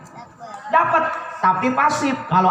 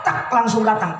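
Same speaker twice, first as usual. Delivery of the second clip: normal talking voice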